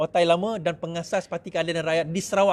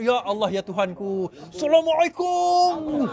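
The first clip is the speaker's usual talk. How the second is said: mock pious greeting; 7 semitones higher, slower than usual. Mocking pious candidate before election.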